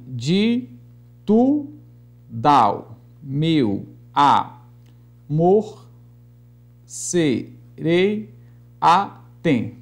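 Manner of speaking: each syllable separate, with long pauses; deliberate teacherly scanning; syllable-by-syllable scansion. Shown as a demonstration. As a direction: break the verse into isolated sounds. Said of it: "vamos escandear aqui alguns versos".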